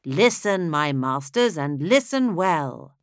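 slow, grand rhyming declamation; chorus summoning the audience to a tale of horrors, grand and slow; declaimed chorus verse. Acted. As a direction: proclaim it like an opera chorus. Chorus opens the opera.

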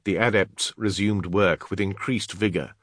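ordinary delivery

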